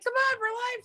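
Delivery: squeaky put-on voice, pushing them; high mock urging voice. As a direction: chirp it up high. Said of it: "I'm like".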